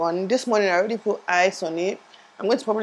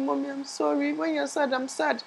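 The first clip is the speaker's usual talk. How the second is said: put-on higher, soft little-child voice; little son's voice; 7 semitones higher, 6 dB quieter than usual. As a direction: speak softly as her small son. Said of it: "And I'm telling me".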